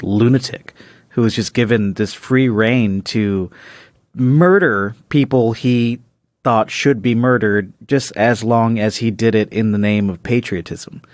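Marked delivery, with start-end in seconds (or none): none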